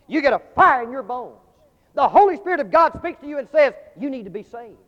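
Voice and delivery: loud, high, driving sermon; preaching at full force. Acted. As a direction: pound each line like an altar call.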